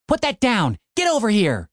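high firm commands; said sharply. A narrator's reading. Tim ordering his wolf off the zombie, sharp. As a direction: order the dog back now. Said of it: "Tim said sharply".

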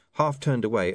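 ordinary delivery

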